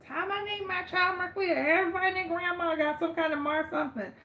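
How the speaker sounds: high-pitched mock-mother voice; imitating mom. Quoting mom on picking her child's name, high put-on voice. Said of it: "And then she's like".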